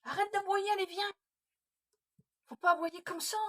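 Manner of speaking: scolding the dog. Real, not acted. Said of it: "Tu me fais crier". (gronder le chien, voix haussée)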